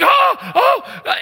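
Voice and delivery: high, loud frightened oh-oh; mock the terrified EMT; retold panic, yelped high; panicked moaning. EMT freaking out after injection.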